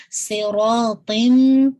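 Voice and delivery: صراطم very slow, ص ر ط heavy, ending in nasal م; heavy letters. Shown as a demonstration. Teacher modelling a heavy صراطم. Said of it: "صوت heavy رکھیے گا".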